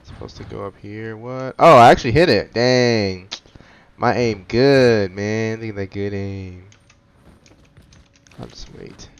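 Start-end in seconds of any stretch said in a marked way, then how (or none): none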